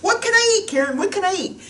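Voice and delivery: high pleading imitation of a dieter; whiny dieter. Mocking dieters who ask what to eat, high whine. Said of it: "people say all the time".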